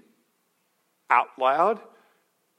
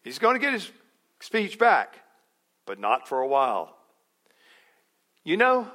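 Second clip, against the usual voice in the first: usual speaking voice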